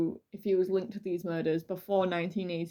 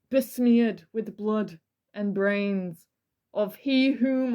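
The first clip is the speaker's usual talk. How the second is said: dramatic reading; slower than usual. Acted. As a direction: savour each gruesome threat slowly. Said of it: "This is a dramatic reading".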